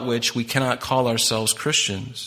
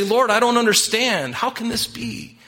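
higher, bewildered plea to the Lord; pleading Nicodemus; 9 semitones higher than usual. Acted. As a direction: ask it like a confused seeker on his knees. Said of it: "That's what Nicodemus is doing".

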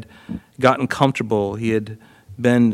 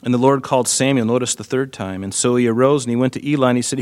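ordinary talk

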